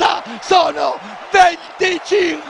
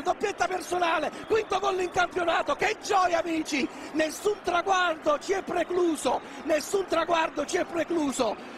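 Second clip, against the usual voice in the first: frantic play-by-play; 10 dB quieter, faster than usual. Real, not acted. Commentator calling Matador's goal, frantic and overjoyed. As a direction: call the play then lose it completely. Live play-by-play then celebration.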